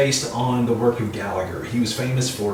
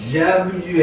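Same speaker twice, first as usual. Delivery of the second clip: ordinary talk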